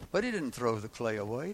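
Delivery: ordinary talk, nothing marked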